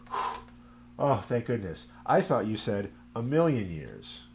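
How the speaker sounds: acted sigh of relief; mock relief. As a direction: let out a whew, play relieved. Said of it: "And the guy says".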